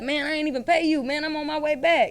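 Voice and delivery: high-pitched, insistent imitation; urgent acted caller. Loyal client calling back about paying, urgently. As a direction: rush to set things right.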